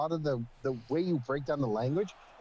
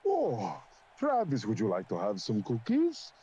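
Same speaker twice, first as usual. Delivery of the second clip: imposing German grandpa; 6 semitones lower than usual. Retold or imitated. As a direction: lower German-accented grandpa voice. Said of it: "it does sound imposing".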